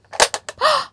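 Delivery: high theatrical gasp; big gasp of surprise; surprised gasp. Acted. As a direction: gasp as if startled. Last toy pops out.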